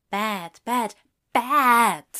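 building to a loud, drawn-out last word; self-scolding. Acted. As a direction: scold yourself, landing hard on the last one. Character scolds himself in thought.

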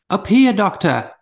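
loud call from above. Acted. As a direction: call down from the tree. Calling down from tree.

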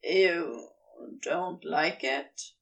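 low, slow, hesitant question; unsure Crowley. Acted. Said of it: "Crowley seems unsure now".

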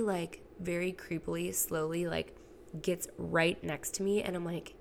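ordinary talk

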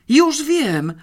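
put-on high, loud excited outburst from a girl; sudden eager interruption. A narrator's reading. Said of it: "przerwała nagle Janeczka".

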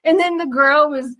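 normal talking voice

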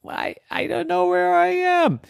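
high, stammering wail; lost and distressed. Acted. Lost star realising she has no idea where she is, distressed. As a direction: whimper it high, bewildered. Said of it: "She was so lost".

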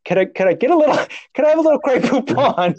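high, hammed-up polite asking; mock-genteel, high-pitched plea through laughter; absurdly casual request. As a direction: ask for rescue as if requesting a condiment. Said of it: "you get the tone of your voice".

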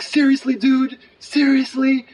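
high, loud, acted-out amazement; excited disbelief. Imitating a homeless man grabbing the twenty, excited disbelief. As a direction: pitch it way up, thrilled and incredulous. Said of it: "really excited".

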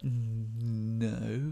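small, soft, drawn-out reluctant answer; sheepish. Retold or imitated. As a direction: hesitate on a long hum, then admit it quietly. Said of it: "kinda sheepishly".

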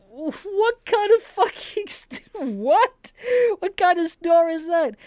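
high, loud mock disbelief breaking into a laugh; incredulous outrage. Customer told large is the smallest size, in high, loud mock disbelief. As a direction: act stunned that large is the smallest. Retold outraged reaction in store.

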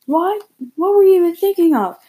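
high, loud scolding; angry mom. Acted. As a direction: scold like a shocked mother.

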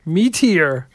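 high, loud Kermit-style croak; Kermit the Frog voice. Acted. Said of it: "Kermit the Frog impression".

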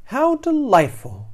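high, gushing fish voice; blissfully foolish delight; delighted gasp. A narrator's reading. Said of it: "gasps the poor, foolish fish".

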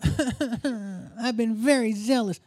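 slow, whining complaint; whiny prophet voice. Acted. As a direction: whine it out like a self-pitying prophet. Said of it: "imagine this in whiny prophet voice".

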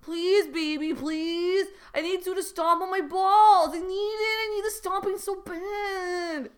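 high, loud, whiny plea; mock sobbing, dragging out the end; tearful begging. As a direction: beg like a hammed-up crying husband. Crying husband begs in story.

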